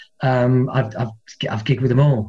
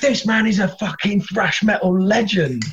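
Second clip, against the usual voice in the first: much higher, pressing insistence; fan insisting; 7 semitones higher than usual. Retold or imitated. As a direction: make them understand his fame. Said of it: "they're like".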